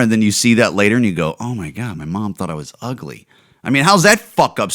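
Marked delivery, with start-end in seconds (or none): 1.4-3.3 mock hurt kid. Kid voiced finding mom's video.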